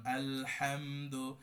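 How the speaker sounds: আলহামদু read very slowly as one smooth word; joined as one word. Shown as a demonstration. Teacher reading the whole word to learners, slow and joined. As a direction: glide through আলহামদু without breaks. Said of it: "সবগুলো একসাথে একটা ওয়ার্ডকে পড়ি".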